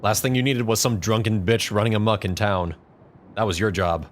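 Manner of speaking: normal talking voice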